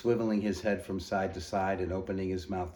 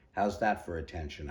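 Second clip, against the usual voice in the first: ordinary delivery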